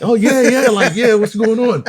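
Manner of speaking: put-on high, loud laughing kid voice; kids answering. Kids finally answering their dad, high and laughing. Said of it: "And they're like".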